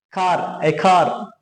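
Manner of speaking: "Car, a car." said very slowly and clearly; slow demo. Shown as a demonstration. Teacher slowly models "Car, a car." for students. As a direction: drag out "Car, a car." for learners. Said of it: "So to emphasize sounds".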